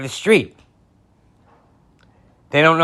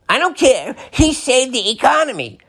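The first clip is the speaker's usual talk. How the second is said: much higher mock whine; mock supporter retort; 10 semitones higher than usual. Mocking a supporter who doesn't care, high whine. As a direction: whine it stubbornly. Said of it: "mock supporter retort".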